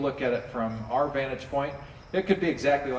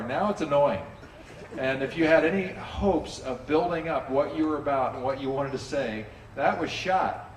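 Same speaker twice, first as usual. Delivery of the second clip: normal talking voice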